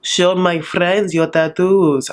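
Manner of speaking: slow imitated foreign accent; Zayed impression. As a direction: put on his accent, taking it slowly. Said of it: "Zayed's then like".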